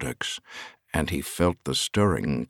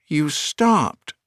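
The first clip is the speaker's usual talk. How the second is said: accusing; 10 semitones higher than usual. A narrator's reading. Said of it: "the woman said accusingly".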